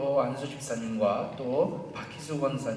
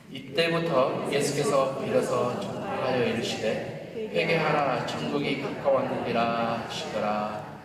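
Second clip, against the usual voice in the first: scripture reading; 8 dB louder than usual. Acted. Leads scripture reading aloud.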